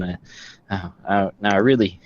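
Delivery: ordinary talk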